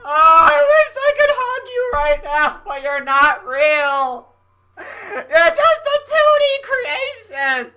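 high, slow, laughing through tears; crying out. Real, not acted. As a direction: cry it to someone you can't reach. Sobbing to the game character.

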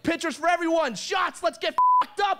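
high, hyped party shout; rowdy bar cheer. Retold or imitated. New guy to the whole bar, high rowdy cheer. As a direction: whip up the bar like a party animal.

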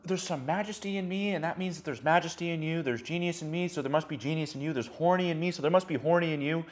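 quiet, sincere inner voice; hushed self-affirmation; soft inner reflection. Retold or imitated. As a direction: say it softly to yourself, with wonder. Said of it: "when you look at somebody You go".

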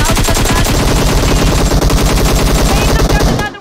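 loud and high, fast at the end; real hype yell; excited shout. Real, not acted. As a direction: shout the announcement with rising thrill. Final game announced, then surprise.

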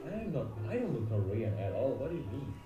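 ordinary talk, nothing marked

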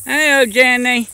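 high, loud sing-song call; calling to animal. Real, not acted. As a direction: call out sweetly to her. Calling to donkey.

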